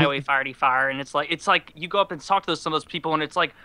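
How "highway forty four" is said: forty-four as "farty-far"; Highway forty-four, vowels opened to "farty-far"; North County pronunciation. Retold or imitated. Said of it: "will just call it farty-far".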